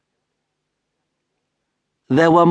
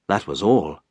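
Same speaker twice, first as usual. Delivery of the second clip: usual speaking voice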